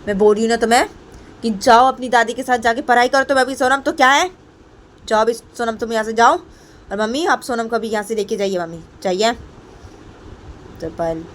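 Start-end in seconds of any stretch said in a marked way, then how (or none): 0.1-9.3 raised, louder impatient command; angry order. Acted. Payal sends Sonam away to study, angry. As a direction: order the child off, voice climbing.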